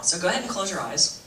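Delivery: soft, low meditation voice with long pauses; guided meditation. Real, not acted. Teacher leads audience through breathing meditation, soft guided-meditation voice. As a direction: guide them gently into calm, hushed.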